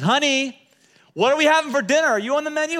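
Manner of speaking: husband playfully shouting a flirty question. Retold or imitated. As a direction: tease her loudly from the next room. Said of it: "kinda playfully raises his voice".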